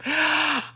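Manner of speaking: high laugh. Real, not acted. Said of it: "laughing at her own story".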